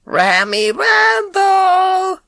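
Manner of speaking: put-on high, slow sing-song call of an old lady; imitating Mrs Bloom. Boy practising the cat call, high and drawn out. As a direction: stretch out the call like she does. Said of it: "imitating her accent, her tone of voice".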